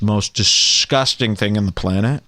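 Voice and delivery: normal talking voice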